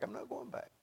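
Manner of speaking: hushed retold refusal. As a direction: say it as if to oneself. Retold resolve, repeated under breath.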